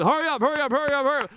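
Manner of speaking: mock impatient mom. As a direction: rush him like an exasperated mom. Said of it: "Mom goes".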